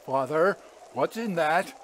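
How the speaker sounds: rising to a high, firm demand; insist, building in determination; determined. A narrator's reading. Otto demands to know the parcel's contents, determined. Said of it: "first signs of determination in his voice".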